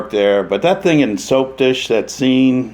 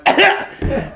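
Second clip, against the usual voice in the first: sudden high hacking cough; short mock cough burst; imitated explosive cough; 10 semitones higher than usual. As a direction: mimic a man coughing out his tube. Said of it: "and he went".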